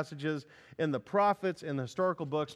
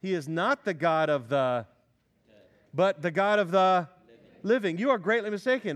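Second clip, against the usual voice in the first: read with force; 3 semitones higher, 5 dB louder than usual. Shown as a demonstration. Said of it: "Look at verse twenty-seven".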